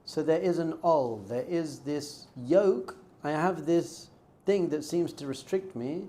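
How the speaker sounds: ordinary talk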